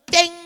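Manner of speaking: loud, drawn-out "ding"; mock fail bell. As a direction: sound a game-show wrong-answer bell. Acts out God's fail signal.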